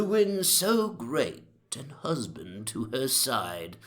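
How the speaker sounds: reciting verse. A narrator's reading. Narrator recites his own amended verse to Johnson, slow and formal. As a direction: declaim the amended couplets slowly. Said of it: "amendment of it".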